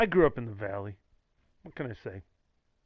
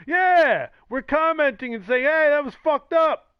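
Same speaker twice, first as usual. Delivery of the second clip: indignant; 10 semitones higher, 6 dB louder than usual. Real, not acted. Ranting at critics.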